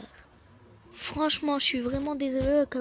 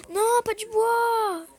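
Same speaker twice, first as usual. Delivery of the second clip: mock wail; 9 semitones higher, 8 dB louder, much slower than usual. Real, not acted. Disappointed by crafting result.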